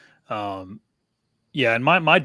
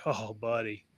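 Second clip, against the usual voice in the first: tender; 9 dB quieter, slower than usual. Retold or imitated. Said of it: "And I was like".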